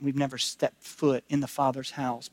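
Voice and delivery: ordinary talk, nothing marked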